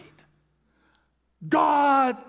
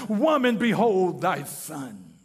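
tender; 7 semitones lower than usual. Acted. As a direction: speak to her gently, as a dying son. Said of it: "is a term of endearment".

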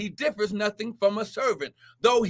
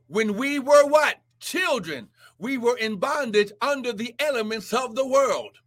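ordinary talk, nothing marked

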